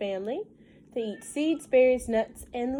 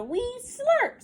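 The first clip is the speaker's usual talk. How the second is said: much higher squeaky little-one voice; baby joeys. Acted. Joeys answering their mother in the rhyme, high and squeaky.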